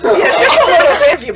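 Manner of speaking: high, loud and quick through laughter; laughing admission. Real, not acted. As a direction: laugh it out as you admit it. Joking admission amid shared laughter.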